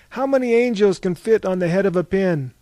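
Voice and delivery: shouted challenge from the crowd; called-out question. Acted. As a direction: call it out high and loud. Crowd member calling out a question.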